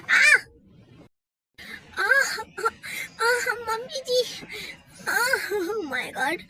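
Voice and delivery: a staged fall, crying out; hammed-up pained cry. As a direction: wail for mother-in-law after the fall. Wife fell on oil.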